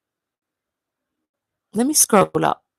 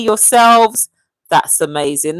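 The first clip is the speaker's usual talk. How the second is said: normal talking voice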